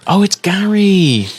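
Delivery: high, slow, breathy-sounding princess voice; drawn-out sweet high voice; cartoon princess.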